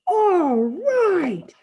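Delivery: high, loud, drawn-out cheer; thrilled, high-pitched yell; excited shout. A narrator's reading. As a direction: whoop it out to the sky. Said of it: "Freak is shouting".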